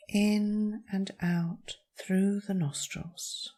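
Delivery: calm meditation voice with long pauses; guided meditation. Acted. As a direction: guide them gently through each breath, leaving space. Leads a breathing meditation.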